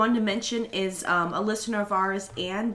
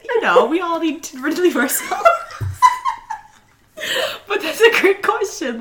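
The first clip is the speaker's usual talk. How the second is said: laughing; 9 semitones higher, 7 dB louder than usual. Real, not acted. Laughing over awkward topic.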